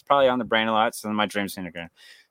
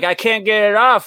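high, louder retold plea; mock panicked protest; 9 semitones higher, 5 dB louder than usual. Dreamer to cops about stuck shark, mock panic. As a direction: protest helplessly, voice pitched up.

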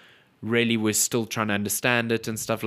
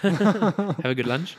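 sing-song; 7 semitones higher, much slower than usual. Real, not acted. Cheery farewell to co-host.

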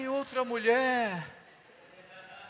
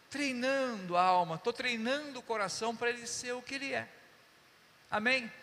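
ordinary talk, nothing marked

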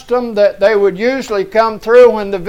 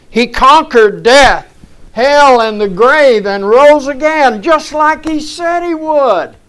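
raised, ringing sermon delivery; full-force preaching; 4 semitones higher than usual. Real, not acted. Preacher telling congregation Jesus conquered death, preaching exultantly. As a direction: preach it in high, triumphant phrases.

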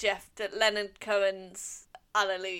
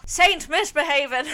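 laughing mock title; 7 semitones higher, 8 dB louder than usual.

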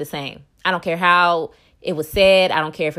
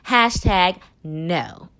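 "hashtag no" drawn out slowly; slow flat refusal; slower than usual. Real, not acted. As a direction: spell out the refusal word by word. Flatly refusing the spokesperson.

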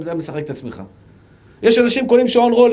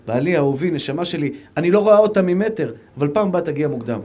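sweet wife; 6 semitones lower than usual. Pretend. Wife gently asking her husband to come earlier next time, sweet and low. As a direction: coax him gently, no shouting.

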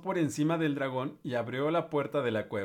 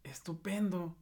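high, delighted exclamation; exclaiming; 5 semitones higher, 5 dB quieter than usual. Acted. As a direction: cheer him on, impressed. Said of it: "exclamó Elizabeth". (exclamando encantada)

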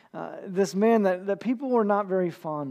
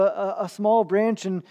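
normal talking voice

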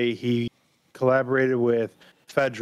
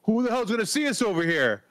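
high-pitched mock yell, kept down in volume; 9 semitones higher than usual. Podcaster retelling Henry being an idiot who starts yelling, mock yell. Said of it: "just starts yelling".

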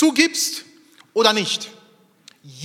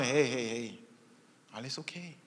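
soft reassurance; 6 semitones lower, 12 dB quieter than usual. Acted. The Lord calms the quarrel.

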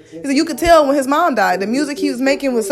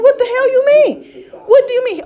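indignant retort; 11 semitones higher than usual. Retold or imitated. Retelling the morning-after fight with Richard, indignant. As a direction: act out outraged disbelief, pitched way up.

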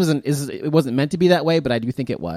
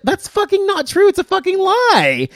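much higher, mocking her outraged protest; mock outraged woman; 4 dB louder than usual. Ridiculing a stubborn woman to friends, much higher mock outrage. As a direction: parody her indignant denial, pitched way up. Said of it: "And, and she's like".

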